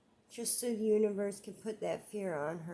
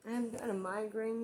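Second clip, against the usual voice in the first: ordinary delivery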